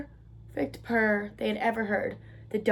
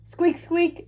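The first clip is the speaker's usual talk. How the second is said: high little squeaks, mildly nervous; lightly voiced nervous mouse; 6 semitones higher than usual. A narrator's reading. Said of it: "said Mouse nervously".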